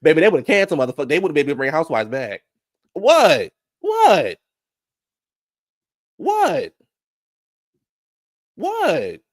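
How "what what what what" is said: high, loud stunned what; incredulous high-pitched exclamation; shocked what. Real, not acted. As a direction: gasp out what in disbelief.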